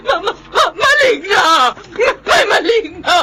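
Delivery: very high, stuttering panicked shout; stammering terror. Acted. Man facing the monster, stammering and shrieking.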